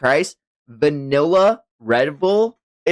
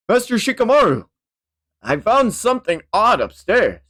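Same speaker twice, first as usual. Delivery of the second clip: higher-pitched, eager reporting voice; urgent character report; 5 semitones higher than usual. Acted. Underling reports a strange vase to Master Shikamaru, urgent and high. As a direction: report the odd find with alarm.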